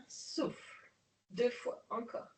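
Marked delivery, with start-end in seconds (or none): 0.1-0.9 breathed-out cue. Shown as a demonstration. Instructor cueing her class to exhale, breathy whisper.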